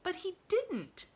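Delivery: high, slow cry; cried protest. Acted. Girl cries out to correct the maid. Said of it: "cried Pollyanna".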